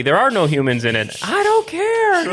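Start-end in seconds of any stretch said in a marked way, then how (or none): 1.2-2.3 much higher, slow put-on careless voice; mock dismissive executive. Podcast host mocking the studio executive, hammed-up dismissive voice. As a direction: toss it off like a cartoon exec who couldn't care less.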